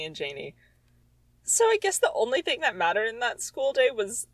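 normal talking voice

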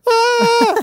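loud, very high mock scream; Nardole's scream. Fan acting both screaming at each other, mock scream. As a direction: shriek as if bumping into someone. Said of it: "Nardole scream that really made me laugh".

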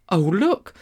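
put-on high, surprised girl's exclamation; crying out. A narrator's reading. Polly discovering the horse came too, high cry. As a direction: cry out as a child who's just noticed. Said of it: "Polly cried out".

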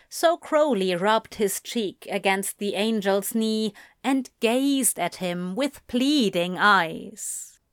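usual speaking voice

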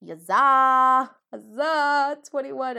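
loud, high victory cheer; celebrating cheer. Real, not acted. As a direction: shout it out in triumph. Celebrating episode count.

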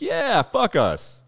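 high, slow mock fan cheer, drawn out; hammed-up fan agreeing gleefully. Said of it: "And everyone's like".

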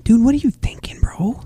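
unvoiced, hushed dude what are you thinking; whispered scolding. Real, not acted. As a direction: scold him under your breath.

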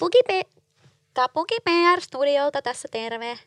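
a hint of a nasal PR caller. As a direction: speak a bit through the nose. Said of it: "Vähän nenästä, nenästä, nenästä".

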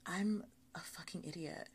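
hushed, breathy self-reproach in bed; self-scolding whisper. Retold or imitated. Lying in bed, scolding herself in a whisper. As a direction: whisper it to yourself like lying awake in bed at night. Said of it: "and I'm like".

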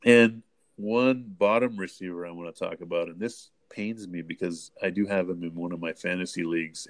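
ordinary talk, nothing marked

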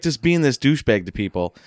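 normal talking voice

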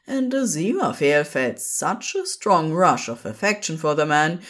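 ordinary delivery

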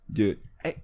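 normal talking voice

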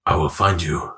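low, deliberate menace; grave action-hero voice; film tough-guy impression. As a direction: threaten up close, pitched right down. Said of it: "I was getting up close".

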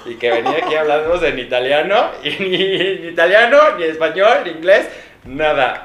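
loud, mocking jeer that builds; mocking sister. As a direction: tease him for failing in every language. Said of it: "mi hermana de allá atrás está cagada de risa".

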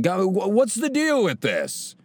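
slow, high Seinfeld "what's the deal". Retold or imitated. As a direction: ask it like Jerry doing a bit. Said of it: "he's like".

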